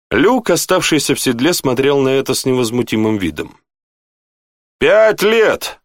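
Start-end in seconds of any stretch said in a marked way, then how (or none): 4.8-5.8 high-pitched, delighted exclamation; excited cry of reunion; exclaiming. A narrator's reading. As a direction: greet an old friend with amazement. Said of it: "воскликнул Тьерри".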